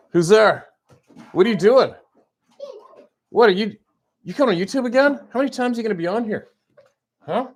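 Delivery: loud, high-pitched teasing questions; playful mock challenge. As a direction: call out in mock surprise at a little intruder. Playful grilling of approaching child.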